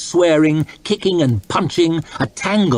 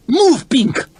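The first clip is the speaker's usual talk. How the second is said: urgent shout; 12 semitones higher than usual. A narrator's reading. Urging flight, calling out.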